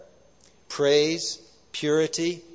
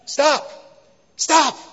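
sudden, high-pitched loud yell; startling shout; 10 semitones higher, 9 dB louder, much slower than usual. Retold or imitated. Pastor re-enacting his passenger suddenly yelling at the driver, startling shout. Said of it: "he just scared me".

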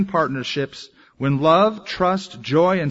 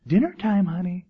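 higher, lilting call to dinner; wife calling dinner; 3 semitones higher than usual. Acted. Wife calls husband home from work to the table, lilting higher call. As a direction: call sweetly to your spouse that dinner's ready.

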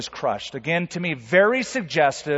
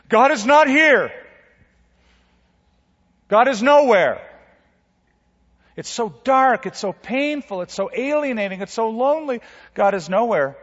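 high, loud lament: God is nowhere; anguished pleading, high and loud; despairing cry; 8 semitones higher, 4 dB louder than usual. Pretend. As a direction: cry out as if abandoned in the dark. Voicing despair in the dark.

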